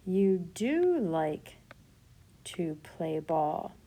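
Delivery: "do" drawn out and stressed, very slow. Shown as a demonstration. Teacher offering another word order, stress on do. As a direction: lean on "do" slowly. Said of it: "You could say this".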